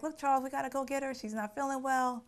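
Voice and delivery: urgent mother. Retold or imitated. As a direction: hurry him along, a mother on a mission. Mother urges father to go.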